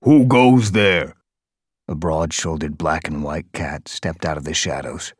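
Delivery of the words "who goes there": slow, loud, high challenge from the dark; a tough guard stopping strangers; guard's challenge. A narrator's reading.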